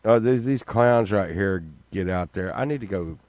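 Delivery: normal talking voice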